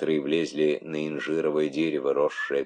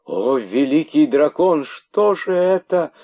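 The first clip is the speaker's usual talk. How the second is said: very high, loud frightened cry; terrified she-snakes hissing; 11 semitones higher, 8 dB louder, slower than usual. Acted. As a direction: gasp in fright. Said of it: "шипели испуганные удавихи".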